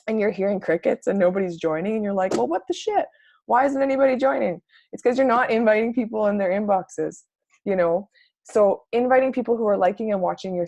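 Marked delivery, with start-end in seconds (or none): 2.3-4.6 high-pitched, indignant mock complaint; mock bewilderment. Coach voicing a poster whom nobody joins, mock bewildered. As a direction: act out a baffled, fed-up poster.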